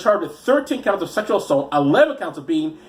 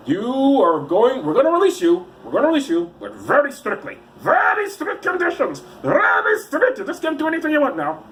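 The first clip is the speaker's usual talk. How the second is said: mock stern police; 9 semitones higher than usual. Police officer releasing suspects on strict conditions, mock stern. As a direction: lay down the law in an exaggerated, climbing voice.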